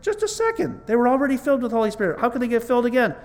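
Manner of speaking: high, rushed protest in disbelief; puzzled objection. Acted. Preacher voices a doubter questioning Acts, incredulous. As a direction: blurt out a baffled objection, pitched high and fast.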